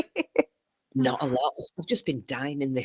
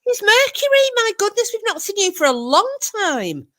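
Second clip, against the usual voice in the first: much higher, delighted welcome; excited greeting; 5 dB louder than usual. Real, not acted. Greeting arriving viewer excitedly.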